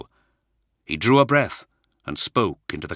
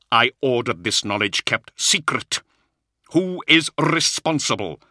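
loud, raised-pitch accusation building higher; angry demand; 7 semitones higher, 6 dB louder than usual. A narrator's reading. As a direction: demand the culprit, slowing on the question. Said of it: "Luther's eyes widened in anger".

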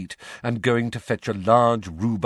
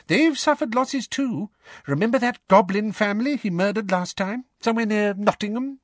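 high-pitched character voice; concerned Mister Weasley; 13 semitones higher than usual. A narrator's reading. Father recalling the goblins' losses, high-pitched character voice. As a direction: pitch it well up, as a man in discussion.

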